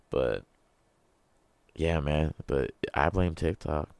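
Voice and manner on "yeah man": ordinary talk, nothing marked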